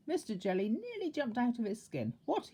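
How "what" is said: startled shout. A narrator's reading. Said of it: "he shouted".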